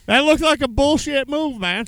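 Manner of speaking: loud, high-pitched holler; shouted support. Real, not acted. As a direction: call out in solidarity to the driver. Shouting support to angry driver.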